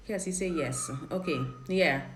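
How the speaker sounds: ordinary talk, nothing marked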